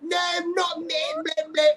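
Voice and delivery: badly faked Scottish, drifting into Cockney; Scottish impression. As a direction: put on a silly high Scottish voice. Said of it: "that was my Scottish impression".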